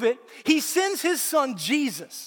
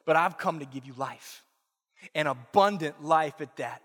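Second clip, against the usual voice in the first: tender promise; 8 semitones lower, 3 dB quieter than usual. A narrator's reading. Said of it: "But Jesus says".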